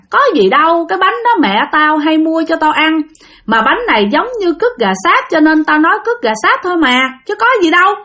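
put-on high, shrugging child voice; cheeky kid. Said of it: "Thì nó cười".

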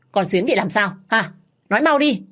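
put-on father urging the boy to speak; urgent demand. A narrator's reading. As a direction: demand an answer now, frantic. Father demands news frantically.

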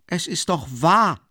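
slow, high, loud angry interruption, leaning on wahr; voice trembling with rage. A narrator's reading. Raskolnikow unterbricht Luschin, bebend vor Wut. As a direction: cut him off, shaking with fury. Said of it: "mit vor Wut bebender Stimme".

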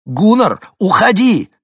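urgent yell from afar; shouting. A narrator's reading. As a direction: shout the warning, high and loud. Hinkin yells warning from car.

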